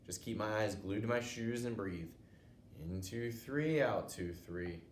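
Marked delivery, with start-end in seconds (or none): none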